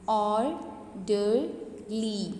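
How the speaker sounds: "Orderly" very slowly in three separate syllables. Shown as a demonstration. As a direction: break "Orderly" into or, der, ly with pauses. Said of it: "यहाँ पे तीन syllables use हुए हैं".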